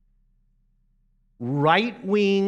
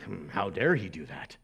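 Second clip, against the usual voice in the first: sniffy disapproval; 11 semitones lower, 9 dB quieter than usual. Retold or imitated. Disapproving churchgoers sitting back.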